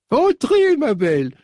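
put-on very high, cracked old lady voice, welcoming; delighted old woman. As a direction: greet a long-lost girl at the door. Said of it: "tout éraillée par la vieillesse".

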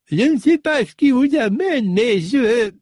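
put-on very high, cracked old lady voice, wary; cranky old woman. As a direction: play a frail old housekeeper guarding her door. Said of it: "tout éraillée par la vieillesse".

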